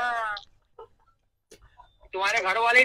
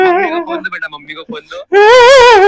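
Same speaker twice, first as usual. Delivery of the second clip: loud demand; 10 semitones higher, 13 dB louder than usual. Pretend. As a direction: call out for the phone, louder each time. Caller demands the mother loudly.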